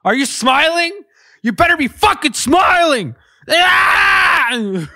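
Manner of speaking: very high, loud screaming yell; shrieking mock threat; headphone warning shout. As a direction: scream at the listener to smile. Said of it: "headphone user warning".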